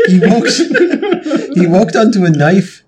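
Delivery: high-pitched, breaking into laughter mid-line; cracking up. Real, not acted. Player failing to keep up the bluff, cracking up. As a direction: try the line but collapse laughing. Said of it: "I can't do this now".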